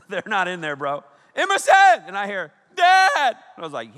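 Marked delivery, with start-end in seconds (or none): 1.3-2.0 loud high call across a store; shouting a name. Retold or imitated. Dad calling for his daughter across the store, loud and high. 2.8-3.4 put-on high loud child's call; child calling Dad. Lost daughter calling for her dad, high and loud.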